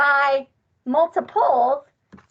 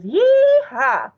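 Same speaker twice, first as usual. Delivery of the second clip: high, loud cowgirl whoop; cowgirl yeehaw; 11 semitones higher, 6 dB louder than usual. Acted. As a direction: holler it like a cowgirl. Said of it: "it's the cowgirl fours".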